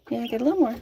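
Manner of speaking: ordinary talk, nothing marked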